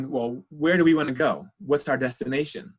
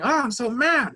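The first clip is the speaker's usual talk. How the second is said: high mock yell of anger; imitating an angry child screaming; 11 semitones higher, 5 dB louder than usual. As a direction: act out a kid's fury, hammed up. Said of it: "when you're really screaming".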